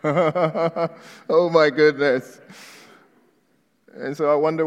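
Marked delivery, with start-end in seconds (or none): 0.0-2.4 slow, laughing oh my goodness. Real, not acted. Pastor recalling the green card approval, laughing. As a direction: laugh through the relief.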